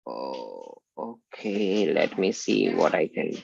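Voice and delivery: ordinary talk, nothing marked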